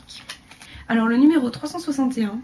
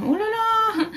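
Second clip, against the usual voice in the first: much higher, sing-song exclamation of surprise; surprised oh là là; 11 semitones higher, slower than usual. Real, not acted. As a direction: catch your own mistake with a high "oh là là". Realises she named the wrong colour.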